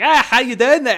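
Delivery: loud, high-pitched Welsh jokester; hammed-up Welsh accent, cracking a joke; mock Welsh accent. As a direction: call it out in a playful Welsh lilt. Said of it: "they're talking in Welsh".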